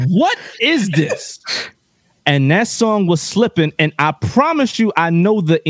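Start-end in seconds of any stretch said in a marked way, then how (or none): 0.1-1.4 slow puzzled question. Retold or imitated. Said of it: "And I said".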